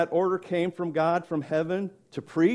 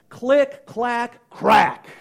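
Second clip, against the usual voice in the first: click, clack, crack, each hit louder, the last one loudest; punchy sound words; 5 semitones higher, 7 dB louder, much slower than usual. Acted. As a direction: snap out the bone sounds one by one. Imitating the bones' sounds.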